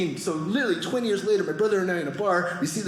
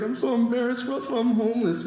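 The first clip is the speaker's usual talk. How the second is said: lightly acted tearful voice, pitched up; retold tearful confession; 4 semitones higher than usual. Homeless friend to generous comic, mildly tearful confession. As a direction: confess it with a mild mock cry. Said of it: "The guy starts crying".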